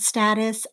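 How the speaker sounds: "status" with a short a, as in "cat"; short-a pronunciation. Shown as a demonstration. Teacher naming a social media word, short-a "status". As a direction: say "status" as STAT-us. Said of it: "I think I hear status more".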